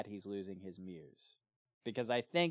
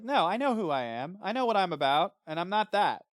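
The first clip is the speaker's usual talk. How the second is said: indignant Henry; 8 semitones higher, 7 dB louder than usual. Retold or imitated. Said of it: "he's like".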